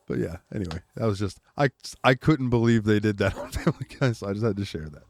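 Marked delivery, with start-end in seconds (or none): none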